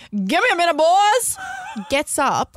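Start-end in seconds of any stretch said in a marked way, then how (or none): 0.1-1.4 put-on high, loud quick call to the boys; dad calling out. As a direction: imitate a boss breezily excusing himself. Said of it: "He just gets up".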